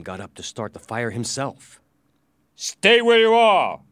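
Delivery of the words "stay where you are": loud, high, drawn-out warning; commanding threat. Acted. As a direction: command the crowd to freeze. Prisoner threatens with magic powers.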